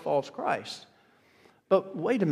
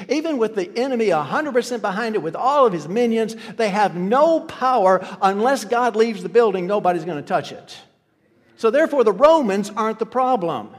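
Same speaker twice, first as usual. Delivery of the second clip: louder, fast driving sermon; forceful preaching; 4 semitones higher, 5 dB louder than usual. Acted. Pastor telling brothers and sisters Romans have no power, preaching loud. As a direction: push it home with force.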